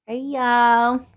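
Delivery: hey y'all, loud and high in a mock Southern drawl; put-on accent. Greeting the chat in a put-on Southern accent. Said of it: "Let me just put on my accent".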